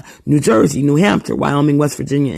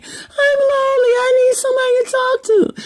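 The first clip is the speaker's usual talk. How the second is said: much higher, whimpering mock loneliness; mock whiny plea. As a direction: imitate a needy late-night caller, whining. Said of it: "you call people in the middle of the night".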